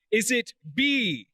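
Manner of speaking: ordinary talk, nothing marked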